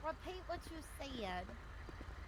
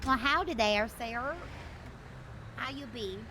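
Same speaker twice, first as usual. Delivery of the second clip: put-on southern accent; 5 dB quieter than usual. Greeting her partner in a light Southern accent. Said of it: "stop talking in my southern accent".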